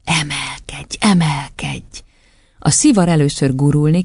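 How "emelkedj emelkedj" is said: slow whispered incantation; whispered command. A narrator's reading. Matilda urging the cigar upward, whispered. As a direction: whisper it urgently, stretching out the word.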